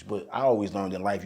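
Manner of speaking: ordinary talk, nothing marked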